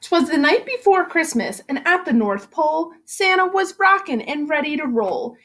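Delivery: put-on lively rhyming read-aloud for kids; story narration. A narrator's reading. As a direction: read it like a bouncy bedtime rhyme. Teacher reads picture book aloud.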